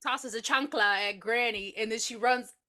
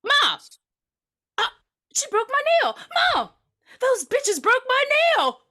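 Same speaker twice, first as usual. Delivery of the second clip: high, loud, bratty wail; bratty girl; 14 semitones higher, 8 dB louder than usual. Retold or imitated. Spoiled girl yelling to mom about her broken nail, high and loud. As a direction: scream for mom like a spoiled kid. Quoting a bratty movie character.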